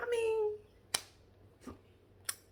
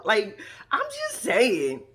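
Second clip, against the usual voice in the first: slow, laughing drawn out; 5 dB louder, much slower than usual. Real, not acted. To viewers still giggling about snoring, laughing. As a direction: let the laugh stretch the words.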